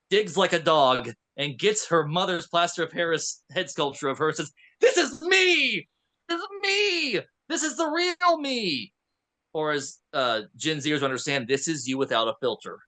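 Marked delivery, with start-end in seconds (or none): 4.8-8.9 high, slow, crying declaration; dramatic cry. Retold or imitated. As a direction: wail it out like a revelation. Girl holds up head, cries out.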